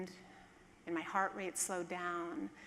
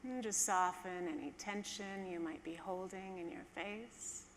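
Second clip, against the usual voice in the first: calm, hushed guide easing listeners down; guided meditation; 4 dB quieter than usual. Acted.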